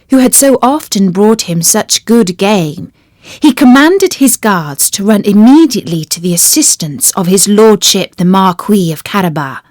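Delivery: plain reading voice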